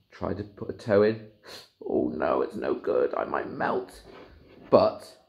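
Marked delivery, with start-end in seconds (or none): 1.9-4.0 worried mutter. Acted. Worried by the river.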